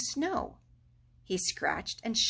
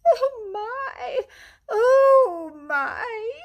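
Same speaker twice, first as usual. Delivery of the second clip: high, loud cries of pain; keen over the hurt; pained wail; 9 dB louder, much slower than usual. A narrator's reading. Mole wails over his cut shin.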